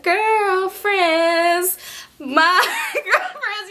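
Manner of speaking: high, slow, laughing coo; tipsy while hugging her friend, high and slow, laughing; giggly drunk. Retold or imitated.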